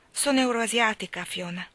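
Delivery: plain reading voice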